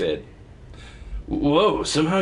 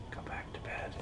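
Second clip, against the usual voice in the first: whispering; 21 dB quieter than usual. Real, not acted. Whispering to the baby.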